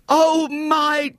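high, slow, melting cry of relief; tender mother. A narrator's reading. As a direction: draw it out lovingly to her found child. Mother recognises her lost daughter.

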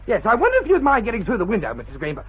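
normal talking voice

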